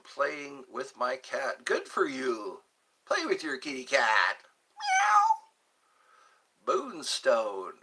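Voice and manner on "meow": squeaky high meow like a kitten; a tiny falsetto meow; high cat meow. Pretend. Asked to play with kitty cat.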